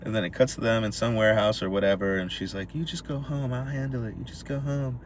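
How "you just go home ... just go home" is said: hushed reassurance. Retold or imitated. Girlfriend voiced quietly.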